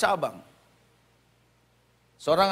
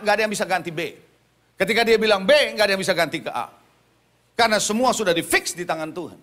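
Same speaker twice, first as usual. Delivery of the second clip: emphatic preacher, punching the start of each phrase; forceful preaching; 4 semitones higher, 4 dB louder than usual. Real, not acted. Preacher urging the congregation to have faith, forceful and emphatic. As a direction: proclaim it with full conviction.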